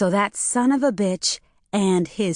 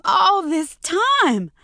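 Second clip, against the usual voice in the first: much higher, slow, stretched anguished wail; 12 semitones higher, much slower than usual. A narrator's reading. Maureen repeating it to Jay, wailing slowly. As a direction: let it break into a drawn-out wail.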